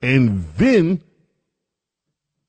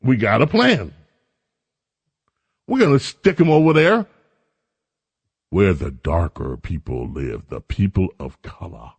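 mock governor; 6 semitones lower than usual. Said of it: "So the governor says".

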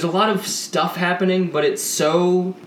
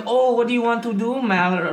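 higher-pitched, mock movie-accent impression; Tommy Wiseau; 5 semitones higher than usual. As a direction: imitate an odd foreign-sounding film actor. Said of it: "[name] sounds like Tommy Wiseau".

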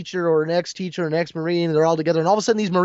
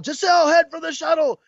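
higher, yelling; yelled order; 10 semitones higher, 3 dB louder than usual. Retold or imitated. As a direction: call the order across the fight. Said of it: "he yells to Giselle".

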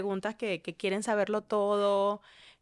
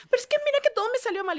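mock dramatic daughter; 12 semitones higher, much faster than usual. Voicing daughter's dramatic complaint.

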